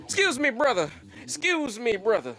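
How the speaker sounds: high, eager preacher pitch; street preacher voice. Retold or imitated. Imitating the bean pie seller who stops him. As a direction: lay it on like a sidewalk salesman. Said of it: "this is how he sounds like".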